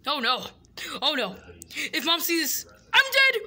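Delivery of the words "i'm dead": loud, high I'm dead; panicked yelp. Acted. Boy fearing Mom will see the wall, loud panic.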